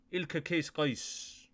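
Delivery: "Ilka Case Qais" very slowly, each word separate; name wordplay. Shown as a demonstration. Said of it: "just a play on words".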